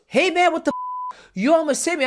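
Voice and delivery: high, mock-angry complaint; hammed-up outraged neighbour; angry homeowner. Host imitating the angry homeowner, mock indignant. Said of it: "This guy comes and goes".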